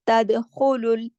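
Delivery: तदखुलुल slow with rasping throat ख; slow Quranic reading of तदखुलुल; tajweed recitation. Shown as a demonstration. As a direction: recite तदखुलुल with heavy guttural ख. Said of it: "सिर्फ खा को मोटा पढ़ा जाएगा".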